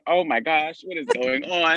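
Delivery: high-pitched imitation of an alarmed driver; mock shock. Cab driver shocked by the fight.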